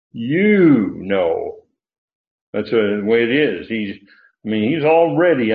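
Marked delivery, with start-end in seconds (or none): 0.1-1.7 long, loud "You", then a lower "know"; emphatic YOU. Shown as a demonstration. Preacher showing listeners Peter's pronoun, emphatic YOU. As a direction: hit "You" hard and hold it. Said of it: "did you get my emphatic "you".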